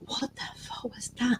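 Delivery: hushed, unvoiced whisper of disbelief; a friend whispering in shock after the vision; stunned whisper. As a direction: breathe it out, stunned, barely voiced. Andrew's stunned reaction retold.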